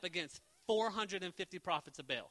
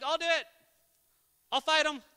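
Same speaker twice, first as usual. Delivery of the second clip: put-on higher, louder eager kid; eager volunteering; 8 semitones higher, 8 dB louder than usual. Young David volunteering to fight Goliath, eager and loud. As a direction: jump at the chance.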